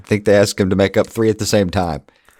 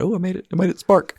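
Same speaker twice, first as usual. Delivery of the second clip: mock giddy; 11 semitones higher, faster than usual. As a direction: imitate childlike glee over a small success. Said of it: "he gets all giddy about".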